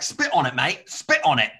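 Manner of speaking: bossy Australian egging on; mock Aussie bloke. Aussie stranger tells her to spit on his car, bossy Australian.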